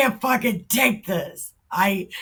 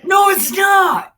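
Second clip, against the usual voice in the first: a sudden, high, loud yell of protest; furious shout; 12 semitones higher, 9 dB louder than usual. Real, not acted. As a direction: shout him down, outraged. Furious outburst at the preacher clip.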